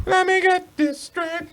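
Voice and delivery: high, slow mocking parrot of the cohost; mocking echo. Teasing his cohost by echoing his words in a high, slow mock voice. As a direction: squeak the phrase back to tease him.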